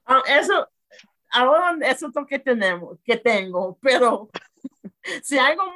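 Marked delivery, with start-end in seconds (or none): none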